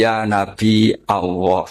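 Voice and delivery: slow "Nabi", catch, then "Allah"; Nabi with hamzah. Shown as a demonstration. Bani Tamim Arabs calling the Prophet, hamzah after Nabi. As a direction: draw out "Ya Nabi Allah" with a glottal break. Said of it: "dulu kalau manggil Nabi".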